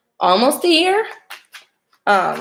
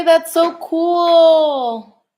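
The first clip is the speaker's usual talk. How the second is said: higher, very slow and drawn out, gushing; thrilled, stretched out; 8 semitones higher, 5 dB louder, much slower than usual. Real, not acted. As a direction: stretch every word in delight. Excited reaction to chat.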